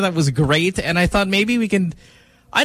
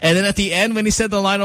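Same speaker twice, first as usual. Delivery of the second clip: usual speaking voice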